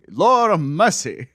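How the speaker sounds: loud, slow, hammed-up Southern drawl; over-the-top Southern accent. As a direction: lay the mock Southern accent on thick. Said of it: "it was a lot".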